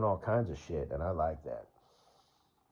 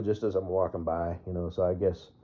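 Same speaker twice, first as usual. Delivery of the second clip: ordinary talk, nothing marked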